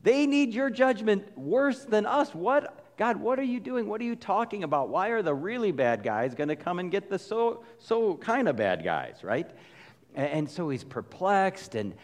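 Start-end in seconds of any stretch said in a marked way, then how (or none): 0.0-9.2 exasperated prophet. Retold or imitated. Habakkuk complaining to God, indignant high protest. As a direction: plead with God, indignant and perplexed. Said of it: "He's like".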